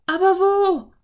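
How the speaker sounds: high, loud, anxious question; urgent question. A narrator's reading. Girl asks her brother where to hide, anxious. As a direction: pitch it right up, at a loss.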